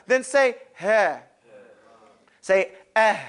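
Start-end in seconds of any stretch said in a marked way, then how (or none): 0.8-1.2 breathy "Ha" that trails out in air; whispered ha sound. Shown as a demonstration. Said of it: "has a whispering sound". 3.0-3.2 "Ah" running on into an airy h; unstoppable whispering h. Shown as a demonstration. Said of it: "You can't stop it".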